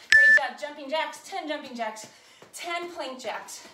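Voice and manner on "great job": shouted encouragement to the class; loud praise. Real, not acted. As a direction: call it out loud.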